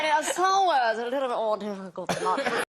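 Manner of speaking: posh British, lips barely touching; posh English accent. Shown as a demonstration. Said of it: "You just don't let your lips touch".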